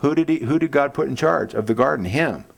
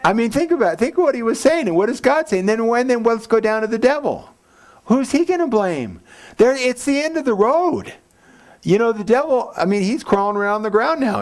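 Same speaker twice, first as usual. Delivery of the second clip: raised, higher preaching voice; fiery preaching; 11 semitones higher than usual. Acted. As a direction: press the point hard to the congregation.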